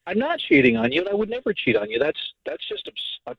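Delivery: real, insistent self-defence; indignant denial. Real, not acted. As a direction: protest your innocence, pitch jumping up. Denies wife's accusation of cheating.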